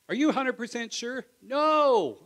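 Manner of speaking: loud, high quizzing then a loud no; acted back and forth, loud and high; mock challenge and shouted answer.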